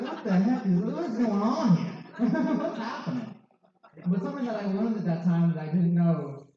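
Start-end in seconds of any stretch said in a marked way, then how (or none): 0.0-3.3 higher, laughing alarm of the parents; mock bewildered parents. Parents hearing his drinking plan, higher laughing disbelief. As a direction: play the baffled parents, laughing. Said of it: "my parents were like".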